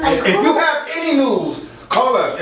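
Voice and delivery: lively, high-pitched news-anchor pitch; pep it up, starting high; pepped up. Shown as a demonstration. Coach showing the young anchor how, pepped up. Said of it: "I need you to pep it up".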